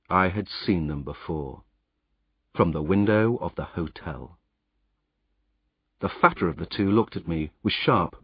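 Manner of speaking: ordinary delivery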